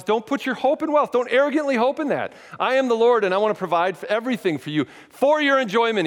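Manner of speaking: high, earnest voice of God; voicing God. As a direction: speak as the Lord, reassuring and strong. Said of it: "And God says".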